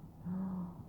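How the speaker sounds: sharp high mock gasp; playful gasp. Real, not acted. Owner starts a chase game with the dog, playful gasp.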